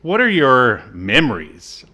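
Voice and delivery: usual speaking voice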